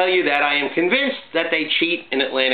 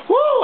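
very high, loud whoop of glee; gleeful woo; 4 dB louder than usual. Real, not acted. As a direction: whoop after the punchline. Celebrating his joke.

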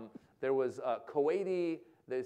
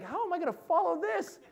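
high-pitched comic dismay; mock panic; 3 dB louder than usual. Comedian dreading going on after dancers, mock panicked.

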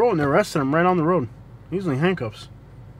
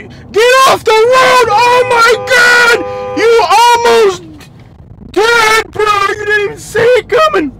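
very high, loud real scream; real exasperated shouting, high-pitched; furious yelling; 8 dB louder than usual. Real, not acted. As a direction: screech at jaywalkers from the car. Yelling at people in the road.

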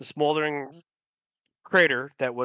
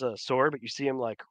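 ordinary delivery

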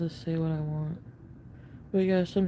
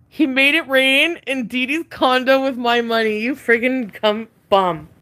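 louder, higher angry outburst; 5 semitones higher, 7 dB louder than usual. Real, not acted. Venting to viewers about boyfriend spending her money, angry. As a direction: spit out the insult, slowing on it.

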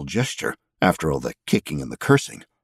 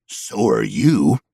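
slow pointed retort; much slower than usual. Acted.

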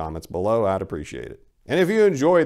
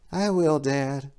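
put-on much higher curious boy; child voice; 5 semitones higher, 3 dB quieter, slower than usual. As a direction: ask dad like a small kid. The boy again.

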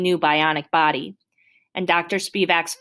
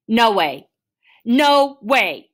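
loud refusal, jumping higher on the repeat; going nuts; 5 semitones higher, 6 dB louder, much slower than usual. Acted. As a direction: shout it in mounting disbelief. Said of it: "I just go nuts".

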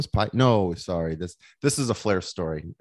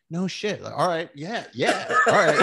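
laughing agreement; 11 semitones higher, 5 dB louder than usual. Retold or imitated. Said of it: "And I was like".

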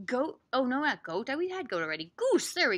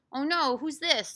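usual speaking voice

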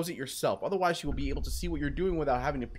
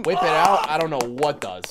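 usual speaking voice

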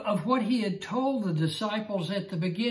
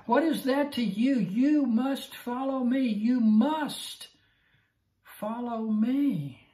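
higher, slow emphatic must follow me; firm Jesus; 6 semitones higher, slower than usual. Acted. Jesus firmly telling Peter to follow him, slow and emphatic. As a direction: lay down the command slowly.

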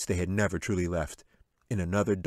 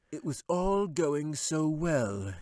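slow, higher wizard voice, muttered; muttering wizard; 9 semitones higher, much slower than usual. A narrator's reading. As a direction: grumble it like a beaten wizard. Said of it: "he muttered".

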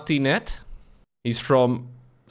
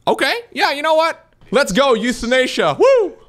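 high, loud sarcastic celebration building to a whoop; mock cheer; 12 semitones higher, 5 dB louder than usual. Streamer to chat, cheering a list item with ironic whooping. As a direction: hype it up like your team just scored.

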